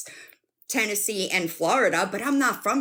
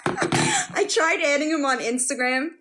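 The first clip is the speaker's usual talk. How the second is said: higher, giggle still in the voice; laughing; 7 semitones higher, 4 dB louder than usual. Real, not acted. Retelling a failed fix to viewers, laughing. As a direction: get the sentence out through laughter. Laughing as she restarts story.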